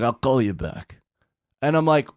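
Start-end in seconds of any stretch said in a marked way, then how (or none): 0.0-1.0 lower, glum mock Russian accent; lightly voiced gloomy Russian-accented clerk; Russian Eeyore. Clerk brushing off a caller, glum Russian accent. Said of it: "Russian Eeyore".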